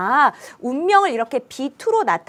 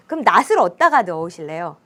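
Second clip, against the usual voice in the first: usual speaking voice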